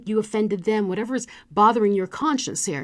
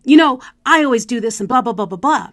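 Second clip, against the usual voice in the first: accusatory tone; 3 semitones higher, 10 dB louder than usual. Shown as a demonstration. Coach demonstrating a blaming tone to an audience, loud and accusatory. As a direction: accuse them, as if they always mess up. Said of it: "an accusatory tone".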